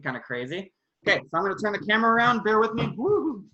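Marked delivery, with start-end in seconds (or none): none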